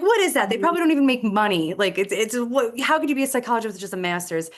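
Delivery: mock-outraged disbelief, mostly quick; incredulous rant. Frustrated senior ranting at a school psychology flyer, loud and incredulous. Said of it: "I'm angry in the hallway and I'm complaining".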